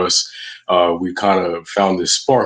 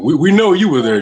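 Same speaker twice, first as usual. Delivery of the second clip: excited; 10 semitones higher, 7 dB louder, faster than usual. Acted. Host laughs about hearing her.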